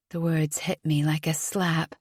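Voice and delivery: ordinary delivery, nothing marked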